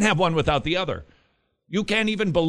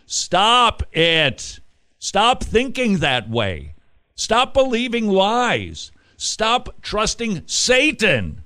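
emphatic exhortation; 6 semitones higher, 4 dB louder, slower than usual. Acted. Preacher exhorting listeners to stop.

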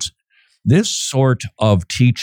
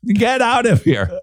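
higher, insistent mock yell; mock aggressive; 9 semitones higher than usual. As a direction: playfully yell them out of the room. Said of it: "passive-aggressive without the passive part".

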